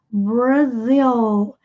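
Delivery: "Brasil" with a curled English R and a clear L at the end. Shown as a demonstration. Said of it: "o L tem som de L mesmo".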